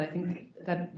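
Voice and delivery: ordinary talk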